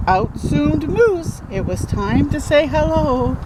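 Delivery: usual speaking voice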